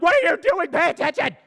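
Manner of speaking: loud, high mock yell at a driver. Driver yelling at a phone-distracted driver on the highway. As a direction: yell through the windshield. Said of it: "And I'm honking like".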